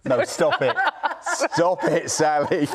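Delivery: laughing through the words; giggling protest. Real, not acted. Host laughing at the clip.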